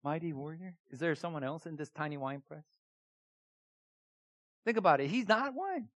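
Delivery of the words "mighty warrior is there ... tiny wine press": much softer, puzzled disbelief; who, me? Said under your breath; incredulous. Acted. Preacher voicing Gideon's stunned reply to the angel, soft disbelief. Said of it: "as Gideon hears this, I was like".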